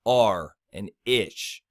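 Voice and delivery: slow, low "R" and "ish", spaced apart; isolated rhyme sounds. Shown as a demonstration. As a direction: sound out "R", pause, then "ish". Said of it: "start with the stress syllables first".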